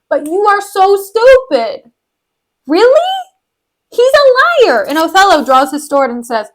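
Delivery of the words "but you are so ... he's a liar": high-pitched mock outrage; exasperated, high-pitched telling-off; incredulous scolding. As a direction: scold him in rising disbelief. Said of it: "Amelia's just like".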